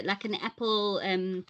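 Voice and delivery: ordinary talk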